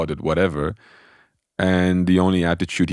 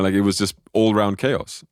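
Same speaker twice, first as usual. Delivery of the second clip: ordinary delivery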